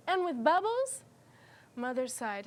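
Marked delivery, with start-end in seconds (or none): 0.1-1.0 put-on high, pleading child voice; Henry's voice. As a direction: ask it like a hopeful little boy. Reading Henry's line aloud.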